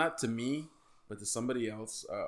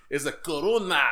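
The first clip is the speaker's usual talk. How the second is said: put-on French accent; 3 dB louder than usual. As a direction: fake a French accent badly. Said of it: "my French accent sucks".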